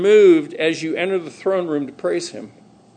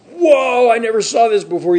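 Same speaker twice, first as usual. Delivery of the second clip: loud, higher burst of amazement; excited discovery; 6 semitones higher, 5 dB louder than usual. Acted. Believer finding something new in the Word, excited. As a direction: blurt it out in wide-eyed wonder. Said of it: "You'll get so excited".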